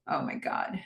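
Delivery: breathless whispered oh my God; whispered dismay. Retold or imitated. Tom staring at the door, whispering in dismay. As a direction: whisper it under your breath, stunned. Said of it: "Tom looks at the door like".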